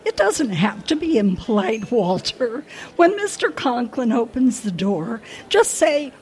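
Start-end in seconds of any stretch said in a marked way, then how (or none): none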